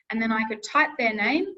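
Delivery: usual speaking voice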